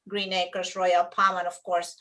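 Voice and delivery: ordinary talk, nothing marked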